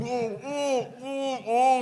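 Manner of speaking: high wavering mock moans; mimicked wobbly crowd wail. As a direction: imitate tipsy people wobbling with drawn-out ohs.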